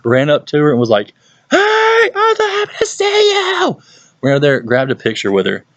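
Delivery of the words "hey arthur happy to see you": very high squealed hello; imitated shrieky fan greeting; excited greeting. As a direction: greet a friend in an over-the-top high squeal. Retold excited greeting of a friend.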